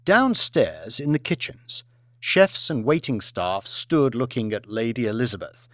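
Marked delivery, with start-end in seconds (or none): none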